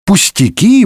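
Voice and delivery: high, rising incredulous question; indignant. A narrator's reading. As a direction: throw the word back in outrage. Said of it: "возмутился гасконец".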